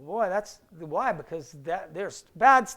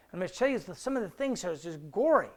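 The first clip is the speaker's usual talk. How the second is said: ordinary talk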